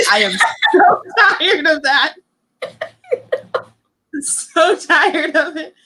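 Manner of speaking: laughing through the words, slowing right down on the end; real giggles breaking up an exasperated complaint. Real, not acted. Laughing about Mulch's book scenes.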